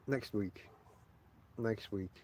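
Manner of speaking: weary sigh through the words. Real, not acted. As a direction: breathe it out, tired and unvoiced. Sighs, putting topic off.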